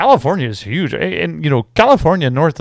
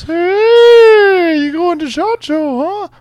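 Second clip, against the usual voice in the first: mocking the doctors; slower than usual. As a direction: whine it up high like an envious doctor. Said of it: "All my doctors".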